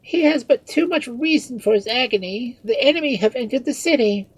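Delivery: high, loud report of disaster; shrill urgent lament; alarmed cry. A narrator's reading. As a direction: cry the bad news in dismay. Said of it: "cried Marianne".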